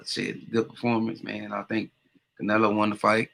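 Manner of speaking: ordinary talk, nothing marked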